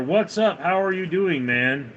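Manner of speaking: usual speaking voice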